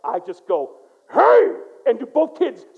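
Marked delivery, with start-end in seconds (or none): 1.1-1.5 sudden loud bark, much higher; a father's booming hey. Retold or imitated. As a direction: bark it out to stop the kids. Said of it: "I can bark".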